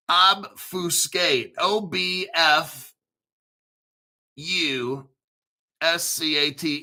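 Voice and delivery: "Obfuscate" slow, syllable by syllable; sounding out. Shown as a demonstration. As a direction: sound out "Obfuscate" slowly for the listener. Sounds out the word before spelling.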